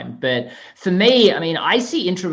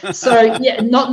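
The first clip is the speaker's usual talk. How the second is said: laughing; 8 semitones higher, 4 dB louder, much slower than usual. Real, not acted. Laughs at the Californians joke.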